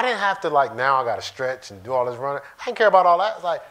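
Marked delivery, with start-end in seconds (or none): none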